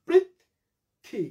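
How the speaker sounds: Prithvi as "prith", long pause, "thi"; broken into syllables. Shown as a demonstration. Teacher demonstrating spelling by sound, syllable by syllable. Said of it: "syllable ধরে ভাঙলাম".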